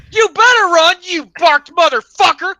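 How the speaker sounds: high, loud taunting yell; mock shout. Tripping narrator yelling after the fleeing tree, mock shout. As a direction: shout after a running enemy.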